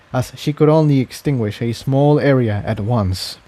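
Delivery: usual speaking voice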